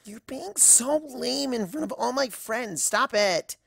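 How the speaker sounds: whiny mortified cry of Mother; a daughter cringing at her parent; embarrassed teen daughter. Acted. Daughter mortified by her mother, whiny cry.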